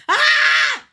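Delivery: long high scream; frustrated yell. Real, not acted. Streamer screaming at the race, real yell. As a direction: let out a loud drawn-out yell. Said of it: "I can't yell".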